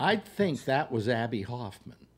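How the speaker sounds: ordinary talk, nothing marked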